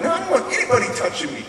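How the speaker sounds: agitated refusal. Retold or imitated. Boy refusing to be restrained, agitated protest. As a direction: blurt it out quickly, high and defensive.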